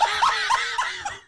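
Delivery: very high, loud squealing outburst; high-pitched shriek. Retold or imitated. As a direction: let out a mock hysterical squeal. Acting out her reaction to the surgeon.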